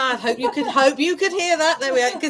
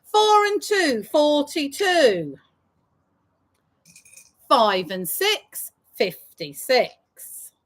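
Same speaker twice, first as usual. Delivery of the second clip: sing-song bingo number calls; drawn-out call then quick repeat; bingo caller; slower than usual. Acted.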